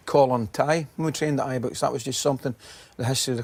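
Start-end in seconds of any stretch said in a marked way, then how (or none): none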